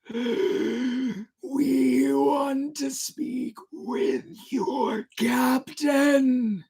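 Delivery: very high, very slow, piercing; piercing ghostly voice. Acted. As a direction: stretch each word out like an eerie call from the boat. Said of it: "A piercing voice from the boat replied".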